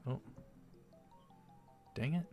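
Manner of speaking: ordinary talk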